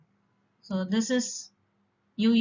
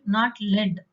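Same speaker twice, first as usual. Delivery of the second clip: ordinary delivery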